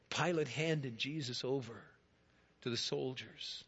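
normal talking voice

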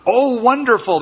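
high bright "Oh, wonderful"; impressed traveler. Acted. Traveler praising Abram's grand name, high and bright. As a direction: sound pleased and impressed, high.

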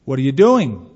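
loud, mildly nervous demand; nervous cry. A narrator's reading. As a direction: call it out loudly, a touch nervous. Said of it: "cried one nervously".

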